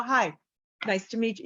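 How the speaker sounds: a boxed-in formal nice to meet you; stiff proper greeting. Shown as a demonstration. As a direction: greet like you were trained to be correct. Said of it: "super proper".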